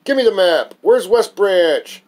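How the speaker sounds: high, loud yell, dragging out the last question very slowly; loud, high demand, slowed right down; angry yelling. Retold or imitated. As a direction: bark it, furious and drawn out. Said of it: "Dan jumped from his seat yelling".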